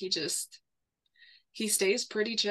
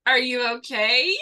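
louder, retold baffled question; incredulous concern; 3 semitones higher, 5 dB louder than usual. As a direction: ask it in mock disbelief. Retelling her alarmed question to boyfriend.

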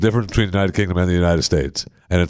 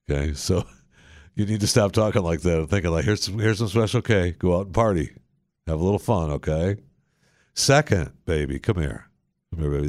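put-on lower, softer cooing as if to a sulking baby; hammed-up soothing, talking down to her; mock baby talk; 4 semitones lower, 4 dB quieter than usual. Coos at the clip like a baby.

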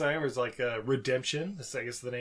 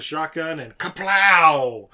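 loud kaplow; 2 semitones higher, 11 dB louder, much slower than usual. Retold or imitated. Recapping a shootout, sound effect.

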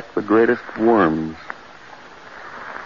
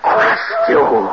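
a sudden scream, much higher; horrified cry. Acted. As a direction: burst out at the memory. Recalling the worm's attack.

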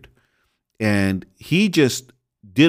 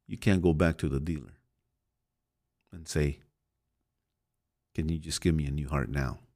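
solemn sermon; 3 semitones lower, 7 dB quieter than usual. Acted. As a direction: let each warning sink in quietly.

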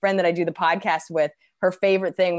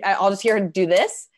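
ordinary talk

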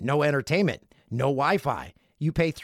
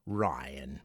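lower, softer breathy echo of "Ryan"; whispered name; 13 semitones lower, 8 dB quieter than usual. Shown as a demonstration.

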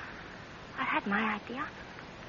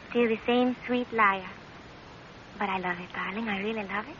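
tender purr; 5 semitones lower, 5 dB quieter than usual. Acted.

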